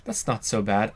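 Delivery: ordinary delivery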